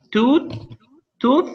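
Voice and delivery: bright quiz-master call of Tooth; high rising example. Shown as a demonstration. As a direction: sing out Tooth as a question to the class. Teacher calls out an example word.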